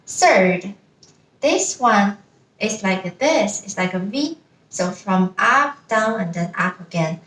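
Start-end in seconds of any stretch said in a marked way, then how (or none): none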